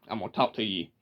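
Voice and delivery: Georgia drawl, "talk to ye"; Southern drawl. Shown as a demonstration. Demonstrating Georgia speech for viewers. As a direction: drawl it with "ye" at the end. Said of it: "they'll say "ye".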